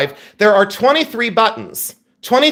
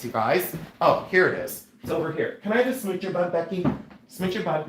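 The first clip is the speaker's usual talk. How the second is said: usual speaking voice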